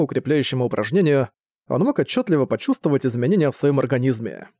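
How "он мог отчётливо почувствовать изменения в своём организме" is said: ordinary delivery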